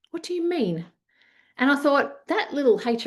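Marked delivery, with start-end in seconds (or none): 0.1-0.8 retold baffled question, pitched high; puzzled. Exec thrown by her mentor's question about hiding, puzzled. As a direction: act taken aback, voice jumping up.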